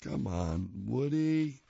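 usual speaking voice

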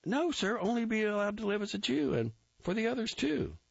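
high, eager recruit reporting; Grossbart voice. A narrator's reading. Recruit reporting to the captain, high eager voice. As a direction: snap it out high and keen.

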